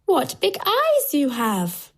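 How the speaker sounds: put-on high, drawn-out child exclamation; wondering little girl, high and slow. A narrator's reading. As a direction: exclaim slowly in a high girl's voice. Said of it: "she says".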